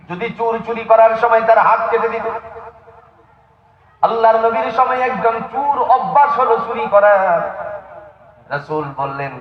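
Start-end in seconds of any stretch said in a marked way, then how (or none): 0.1-7.4 high sermon storytelling; impassioned preaching. Acted. Preacher introducing story of habitual thief, high impassioned preaching. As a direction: preach the tale in a raised pitch.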